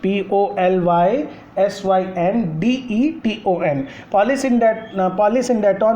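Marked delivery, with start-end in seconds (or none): 0.0-3.9 slow, letter-by-letter spelling; spelled out. Shown as a demonstration. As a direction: spell it out one letter at a time. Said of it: "let me speak it for you".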